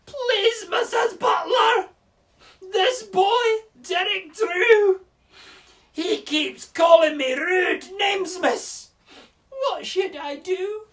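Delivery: put-on very high, slow, loud Scottish child's wail; Scottish accent. Scottish pupil upset at Derek Drew's name-calling, high wailing accent. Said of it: "this is my best Scottish accent".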